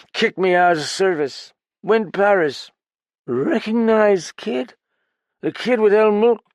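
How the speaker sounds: high, loud, slurred, desperate; drunk outburst. Acted. Drunk pounding the table to confess, loud and slurred. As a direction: blurt it all out.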